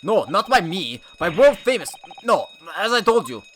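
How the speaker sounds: put-on high, loud accented excuses; flustered sailor. Sailor excusing himself to officer, loud accented protest. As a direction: defend yourself to the officer in broken English.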